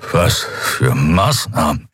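slow, laboured question; weakened captive. A narrator's reading. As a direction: ask it with great effort, barely upright. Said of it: "konnte sich nur mit Mühe aufrecht halten".

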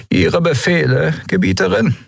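high, thin minister voice; a servile minister asking orders. Acted. Minister addresses empress.

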